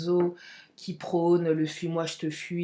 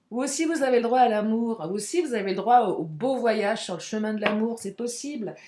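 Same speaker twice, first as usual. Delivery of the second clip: ordinary delivery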